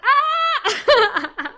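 high, loud excited scream; joyful scream. Retold or imitated. Heba hearing she got the part, ecstatic scream. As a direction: shriek with delighted disbelief.

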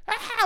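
short high-pitched mock scream; imitated scream. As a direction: mimic a child's sudden scream. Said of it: "he started screaming".

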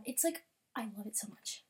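breathless whispered love; hushed gushing; awed whisper. Real, not acted. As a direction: whisper it overwhelmed. Overwhelmed by art.

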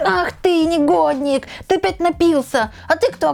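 high shrill scolding voice; shrill nagging grandma. Retold or imitated. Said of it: "жена Мармеладова подлетает и говорит". (визгливая ворчливая тётка)

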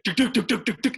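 high, loud tuk tuk mimicking bumps; bumpy cart noise.

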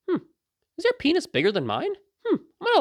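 high, puzzled musing; mock curiosity. Sizing up a naked troll at face value, mock-curious.

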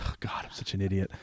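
soft, unvoiced self-mockery; embarrassed whisper. Real, not acted. Host scolding herself for the slip, quiet whisper. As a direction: whisper it as if hiding your face.

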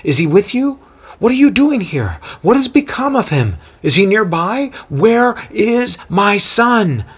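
high, frantic worried mother; anxious rush of questions. A narrator's reading. Mother fearing for her son, questioning Paul anxiously. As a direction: pile on questions in panic, slowing at the end. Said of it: "an assault of additional questions".

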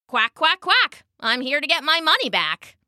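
high quacking duck voice, quack jumps up high; perky duck chant; plucky duck. Acted. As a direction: chirp it out like a brave little duck.

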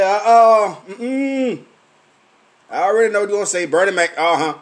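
dismissive. Retold or imitated. Refusing to hear it.

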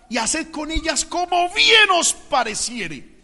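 slow, high dramatic reading; declaimed scripture plea. Acted. Lot pleading with the men of Sodom, declaimed. Reads Lot's speech.

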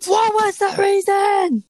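high slow shout, drawn out; Kimi Räikkönen. Retold or imitated. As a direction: shout it like an annoyed racing driver. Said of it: "Kimi Räikkönen".

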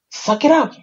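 mocking the people who told her to move on; dismissive suck it up. Imitating people telling a new mother to toughen up, dismissive.

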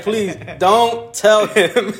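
slow, laughing begging; laughing plea. Retold or imitated. Nephew begging the principal not to tell his uncle, slow and laughing. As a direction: drag it out, laughing while you beg. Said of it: "I was like".

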